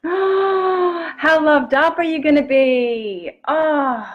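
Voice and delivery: gasp, then a slow, loud, high exclamation; excited gasp. Real, not acted. Coach thrilled at viewers' self-love, slow loud gasp. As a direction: burst with delight at the thought.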